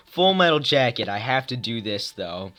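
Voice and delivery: normal talking voice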